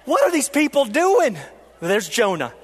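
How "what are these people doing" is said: exasperated outburst. Retold or imitated. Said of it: "and I'm like".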